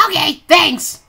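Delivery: excited. Acted. Cheery reply to game host.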